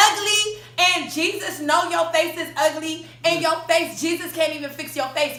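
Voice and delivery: high, emphatic retold tirade; full conviction. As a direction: declare it like righteous judgment. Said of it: "she put it with so much conviction".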